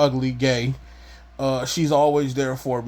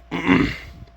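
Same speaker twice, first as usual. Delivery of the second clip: throat clearing; 4 semitones higher than usual. Real, not acted. Clears throat after asthma.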